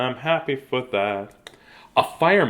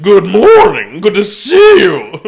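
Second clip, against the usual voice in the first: louder, odd-timbred joke voice; silly put-on voice; 8 semitones higher, 15 dB louder, slower than usual. Reader teases Miss Christie about talking in a silly voice. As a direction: ham it up in a goofy voice. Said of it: "that's how I'm gonna do it".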